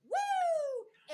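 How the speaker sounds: high, drawn-out "woo"; light mock whoop of a wild crowd; imitated crowd cheer. Imitating the crowd going wild, high cheer. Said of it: "the crowd goes wild".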